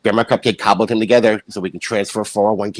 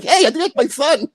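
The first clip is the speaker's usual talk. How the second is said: high, slow hammed-up call; mock calling father; 13 semitones higher than usual. Imitating the father calling across the desert, high and slow.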